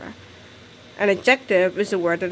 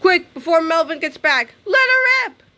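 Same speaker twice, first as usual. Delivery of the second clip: higher and louder, peaking on rip; excited urging; 6 semitones higher, 4 dB louder than usual. Acted. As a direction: egg it on at full voice. Urging the fart on.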